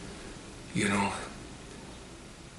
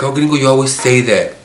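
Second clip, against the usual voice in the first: put-on teasing kids; 5 semitones higher, 9 dB louder than usual. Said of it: "And they were like".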